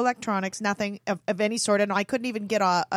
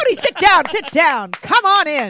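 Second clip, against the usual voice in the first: loud, high welcome call; 6 semitones higher, 9 dB louder than usual. Pretend. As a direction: call him in, playful and loud. Calling a guest to sit.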